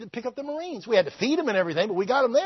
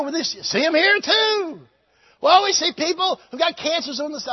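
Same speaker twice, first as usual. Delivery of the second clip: mock doubters; 11 semitones higher, 7 dB louder than usual. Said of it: "imitating people challenging him".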